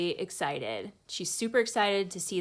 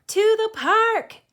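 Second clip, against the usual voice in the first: much higher, much louder, slow rallying yell; 11 dB louder, slower than usual. A narrator's reading. Girl yells to the crowd to go to the park, full yell. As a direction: shout it to the whole street. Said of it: "I yell at the top of my lungs".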